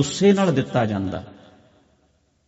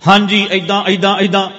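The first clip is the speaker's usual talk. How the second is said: loud, raised-pitch demonstration; loud inner voice; 5 semitones higher, 6 dB louder than usual. Shown as a demonstration. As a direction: rattle it out high and insistently loud. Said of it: "ਇੱਕ ਹੁੰਦਾ".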